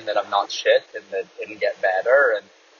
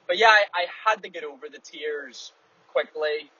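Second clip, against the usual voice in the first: usual speaking voice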